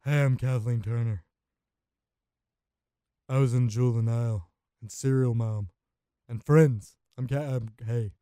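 loud put-on voice of a famous actress; a loud, hammed-up impression of a famous actress; Kathleen Turner impression. Shown as a demonstration. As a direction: boom it out as a movie-star voice for a laugh. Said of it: "I'll do a Kathleen Turner impersonation".

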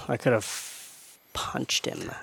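breathy whisper under the breath; whispered frustration. Real, not acted. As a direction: hiss it like you're seething. Exasperated aside about the buck.

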